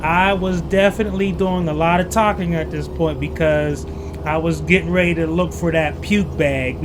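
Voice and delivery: ordinary talk, nothing marked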